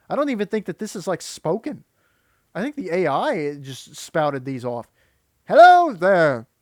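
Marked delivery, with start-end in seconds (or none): 5.5-6.5 slow, much louder mock of a stilted robotic greeting; drawn-out loud mock AI hello. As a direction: parrot the clip's odd inflection to ridicule it. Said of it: "with that inflection".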